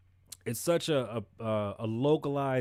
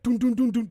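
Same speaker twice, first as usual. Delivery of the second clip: loud, high dun-dun-dun knocks; imitated door knocking; 10 semitones higher, 7 dB louder than usual. Dog the Bounty Hunter banging on a door, imitated loud knocks. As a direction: pound out the knocks with your voice. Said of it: "banging on the door".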